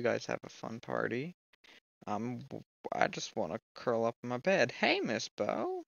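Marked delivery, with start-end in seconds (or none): 4.7-5.8 high, slow sing-song hello; sing-song greeting. Acted. Player greets the creepy doll, sing-song high. Greeting a game character sweetly.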